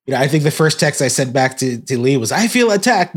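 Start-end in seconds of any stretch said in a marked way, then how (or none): 2.3-3.1 high, mock-wounded joking tone; joking mock offense. Texting a friend back, jokingly mock-offended. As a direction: play up the offense as a joke. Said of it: "it was really jokingly saying it".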